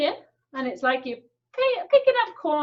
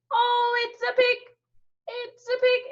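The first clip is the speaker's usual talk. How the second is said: oh, look, a pig, much higher; higher delighted surprise; 9 semitones higher than usual. Acted. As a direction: pitch up in mock wonder. Discovering pig.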